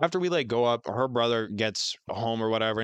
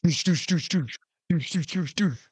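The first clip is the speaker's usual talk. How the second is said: mock pounding on a door, a little higher; imitated door knocking; 5 semitones higher than usual. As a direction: knock knock with your voice, doosh doosh. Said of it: "the door keeps knocking".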